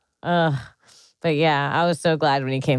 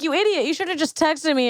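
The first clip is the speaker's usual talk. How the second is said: high-pitched mock exasperation; exasperated scolding; 9 semitones higher than usual. Telling off sister's boyfriend for calling, exasperated.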